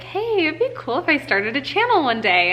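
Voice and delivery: casually fish for his support; hinting. Retold or imitated. She drops a playful hint to Hunter about starting a channel.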